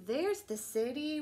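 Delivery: gentle bedtime story reading; reading to children. Acted. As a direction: bedtime picture book read-aloud. Reading picture book aloud.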